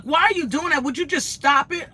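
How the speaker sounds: high-pitched mock exasperation; imitated upset. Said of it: "she was so upset".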